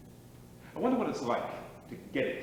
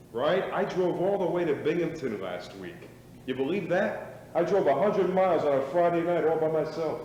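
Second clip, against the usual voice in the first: boastful shout; 5 semitones higher, 7 dB louder than usual. Acted. Boasting loudly to old teammates.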